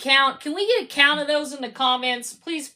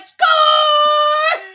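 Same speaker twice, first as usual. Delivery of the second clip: long, high celebratory shout; drawn-out stadium scream of joy; sports fan's cheer; 13 semitones higher, 9 dB louder than usual. Acted. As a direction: yell it like a goal call, stretched out. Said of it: "when they score and you're like".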